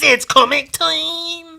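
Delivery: closing the chant, high and slow; drawn-out high finale. Acted. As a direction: stretch it like a cartoon announcer. Show segment opener.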